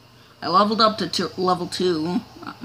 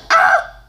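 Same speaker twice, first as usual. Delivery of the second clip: very high, louder short shriek; startled scream; 9 dB louder than usual. Real, not acted. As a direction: shriek at the sudden crash. Crash in game.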